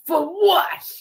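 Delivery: a sharp, high, loud "for what?"; incredulous cop. Retold or imitated. The police officer questioning her prayer, high and loud in disbelief. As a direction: fire it back in disbelief. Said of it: "he was like".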